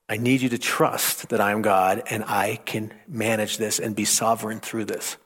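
soft, tender God voice; calm him softly; gentle reassurance. Pretend. God reassuring Russ softly, tender and low. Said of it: "Imagine him saying that to you".

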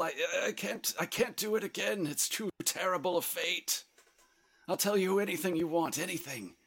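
high, quavery begging with stumbling repeats; desperate pleading. Acted. Game master as Levine begging his attacker to spare him, pleading desperately. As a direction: beg for mercy in a thin, high-pitched voice.

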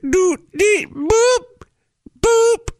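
high beeping noises, like a card terminal; imitated keypad beeps. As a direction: chirp each beep up high like a keypad.

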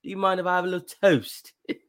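high, prim polite mock-British voice; proper English kid. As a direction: ham up a dainty English boy asking for toast. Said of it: "Who is this English".

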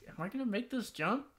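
high, soft worried self-questioning; mock nervous doubt. Player doubting a first-person parkour jump, mock nervousness. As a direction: ask yourself in a small, high voice. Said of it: "where you're like".

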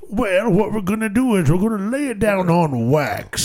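hint of an old record man. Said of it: "You sounded old, [name]".

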